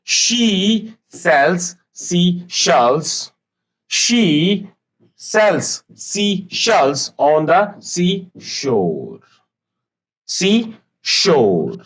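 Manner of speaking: very slow, high, every sh drawn out; slow tongue twister. Shown as a demonstration. Teacher slowly demonstrating the tongue twister, high and drawn out. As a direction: model "she sells seashells" word by word for the class. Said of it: "मैं एक बार फिर से पढ़ रहा हूं".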